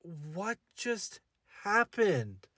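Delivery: soft, stunned question; stunned whisper. Retold or imitated. Date left alone at the table, soft and stunned. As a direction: breathe it out in shock. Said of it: "I was like".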